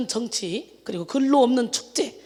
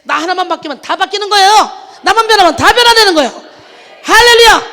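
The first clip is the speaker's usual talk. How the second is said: much louder, much higher, laughing proclamation; preaching at full force; 7 semitones higher, 15 dB louder than usual. Acted. Preacher urging the congregation to change, exultant shout. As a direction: peak of the sermon, shout it out with joy. Sermon climax.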